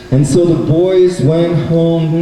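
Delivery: ordinary delivery, nothing marked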